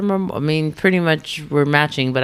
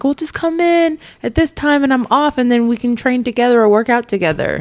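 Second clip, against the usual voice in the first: much higher, lilting quoted voice; imitated invitation; 8 semitones higher than usual. Client quoting the trainer's workout offer, high put-on voice. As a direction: pitch it up as an easy invitation.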